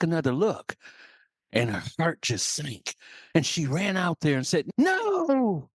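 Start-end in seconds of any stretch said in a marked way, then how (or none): 4.8-5.7 high, drawn-out cry of NO; long, high-pitched protest; alarmed mother hen. Acted. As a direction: call out in high alarm to stop them.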